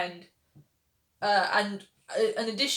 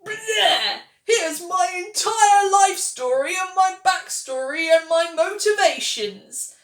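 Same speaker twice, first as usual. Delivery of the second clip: much higher, louder and slow, hammed-up grand announcement; overdramatic TV character; 10 semitones higher, 9 dB louder, slower than usual. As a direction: parody a character loudly dumping her whole backstory. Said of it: "that is how everyone talks in Ratched".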